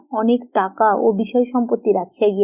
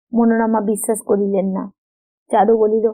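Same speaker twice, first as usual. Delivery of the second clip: ordinary delivery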